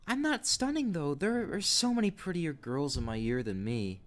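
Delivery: put-on high, unsure teen girl; a nervous girl hesitating; timid young girl voice. Young girl texting an older man, high timid voice.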